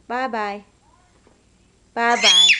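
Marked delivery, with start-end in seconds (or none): 1.9-2.5 Bye-bye yelled out much louder; shouted bye-bye. Shown as a demonstration. Toddler told to wave, Bye-bye shouted loud. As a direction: bark out Bye-bye at full volume. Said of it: "You were saying bye-bye nicely".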